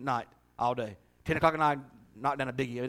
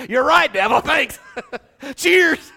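laughing loudly through the words; 11 semitones higher, 13 dB louder, slower than usual. Real, not acted. Preacher laughing at his own devil joke, loud. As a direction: crack up while toasting.

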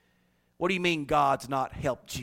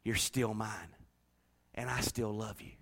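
very soft, low and gentle; God's tender voice; 6 semitones lower, 8 dB quieter than usual. Acted. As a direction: speak as God reassuring a sinner. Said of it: "And God says".

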